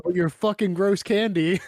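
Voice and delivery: slow, mock-grateful jab; sarcastic thanks. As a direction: thank them while insulting the gift.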